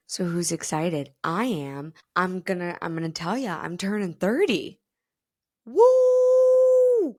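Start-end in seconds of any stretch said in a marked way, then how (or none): 5.6-7.1 very high, loud long woo; celebratory woo. Real, not acted. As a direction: cheer like at a party. Celebrating turning thirty.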